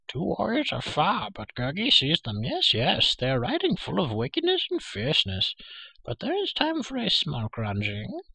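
high, swooping creature voice; pleading Gurgi. A narrator's reading. Creature reports warriors and begs for food, pleading. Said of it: "Gurgi pleaded".

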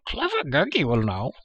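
high, swooping creature voice; eager Gurgi cry. A narrator's reading. Creature volunteers to scout from the pine, crying eagerly. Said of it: "he cried".

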